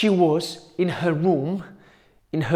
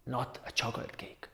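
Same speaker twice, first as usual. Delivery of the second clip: softer, whispered accusation; spooky whisper; 4 semitones lower, 14 dB quieter, faster than usual. Acted. Aunt whispering her accusation to Caterineta, spooky and hushed. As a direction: hiss it out like a secret threat.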